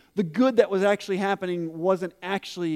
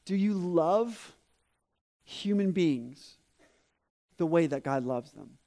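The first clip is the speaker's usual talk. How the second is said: earnest, slow, soft challenge; 3 semitones lower, 4 dB quieter than usual. Real, not acted.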